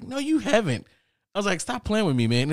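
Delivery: high-pitched protest; acted-out refusal to believe it; disbelieving denial. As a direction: push back in a high voice. Acted denial of roommate's claim.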